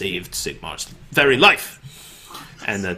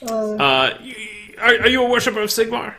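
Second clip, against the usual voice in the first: high eager templar; 11 semitones higher than usual. Acted. GM voices Templar questioning.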